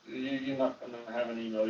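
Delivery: ordinary delivery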